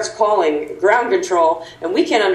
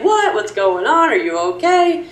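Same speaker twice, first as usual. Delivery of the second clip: surprised concern; 7 semitones higher than usual. Retold or imitated. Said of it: "they're like".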